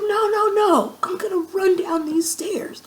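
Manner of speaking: high, loud mock protest, imitating her drunk self; drunken insistence. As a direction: overrule your friend in a high, excited voice. Drunkenly insisting over friend's objection.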